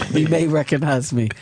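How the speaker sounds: self-important African accent, imitated; low-pitched African-accented boast. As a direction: imitate an African friend introducing himself grandly. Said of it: "he's got this African accent".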